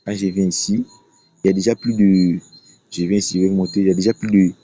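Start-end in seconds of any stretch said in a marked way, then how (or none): none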